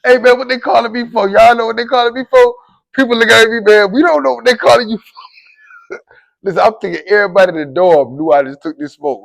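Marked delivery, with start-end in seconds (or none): 0.0-2.5 loud, high, pleading question; worked-up, raised voice; urgent asking around. Retold or imitated. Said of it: "I'm asking people". 3.6-5.2 loud, high retort; imitating annoyed dorm mates; exasperated reply. Others answer his frantic question.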